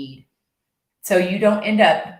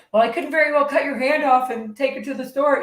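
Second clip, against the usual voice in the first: higher, louder hammed-up Homer; 8 semitones higher, 4 dB louder than usual. Homer excusing his selfish birthday gift. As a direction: play the clueless husband's excuse. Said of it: "He's like".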